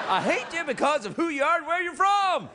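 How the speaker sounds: playful mock hate speech, breaking into laughs. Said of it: "People don't go".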